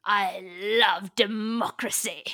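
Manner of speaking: slow, drawn-out emperor voice; Palpatine. Acted. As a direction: stretch it out like a scheming villain. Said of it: "she's very much Palpatining in this moment".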